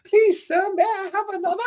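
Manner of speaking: high, meek beggar's plea; pleading orphan. Pretend. Hungry stylist begging for food like a meek orphan. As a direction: beg like a starving orphan.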